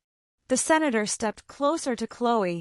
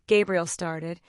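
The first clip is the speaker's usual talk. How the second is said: ordinary delivery, nothing marked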